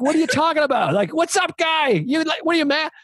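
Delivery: high-pitched mock bravado; imitated oblivious friend. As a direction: play the cocky drunk buddy. Imitating oblivious friend challenging strangers.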